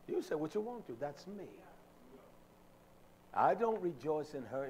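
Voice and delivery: ordinary talk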